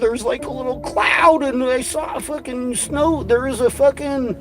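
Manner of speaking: high, whiny mock of a crackpot. Ranter mocking Snitchard's rain rambling, high whiny parody. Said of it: "He's like".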